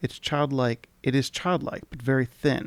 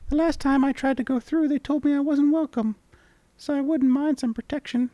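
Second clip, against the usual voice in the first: much higher, squeaky sprite voice; charming tiny creature; 13 semitones higher, 4 dB louder than usual. Acted. Tiny creature charmingly greeting the traveller. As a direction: chirp it like a tiny fairy. Voicing the mini mite.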